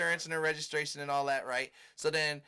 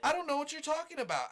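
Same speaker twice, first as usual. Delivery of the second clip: much higher, mock innocent woman; mock flustered woman; 11 semitones higher than usual. To him, the driver denies the crash in a high mock voice. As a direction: play dumb in a high put-on voice.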